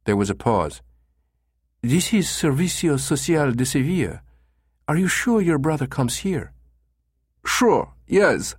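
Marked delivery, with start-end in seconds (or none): 1.8-6.5 higher Spanish female receptionist; doubting but polite woman on the phone; pleasant female voice. A narrator's reading. Receptionist doubting the caller, pleasant female voice. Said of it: "a pleasant female voice". 7.4-8.6 high German tourist voice; thick German accent. A narrator's reading. Caller assuring receptionist, thick German accent. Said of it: "with a thick German accent".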